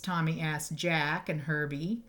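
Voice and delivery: ordinary delivery, nothing marked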